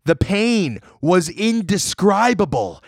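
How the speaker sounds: high, slow, drawn-out; dramatic slow telling. Pretend. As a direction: stretch each word like a horror tale. Dramatic retelling of torment.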